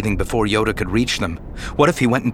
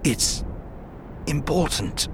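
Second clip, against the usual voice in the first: softer, faint words of a dying man; weak voice; 5 semitones higher, 7 dB quieter than usual. A narrator's reading. Dying Obi-Wan insists to Anakin, faint and soft. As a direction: barely get it out. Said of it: "his voice so weak".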